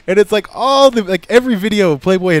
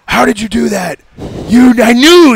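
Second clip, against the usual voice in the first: higher and louder, suddenly delighted; excited realization; 9 semitones higher, 9 dB louder, slower than usual. Retold or imitated. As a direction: burst out like he just figured it out. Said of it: "his face, like, instantly lit up".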